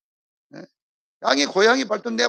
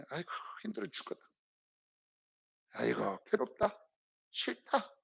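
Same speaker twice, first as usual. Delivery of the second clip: soft weary whine, mock sighing; soft, sighing mock complaint about a hard life; mock groaning complaint; 12 dB quieter than usual. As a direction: moan it like a tired grumbler. Complaining at the bush.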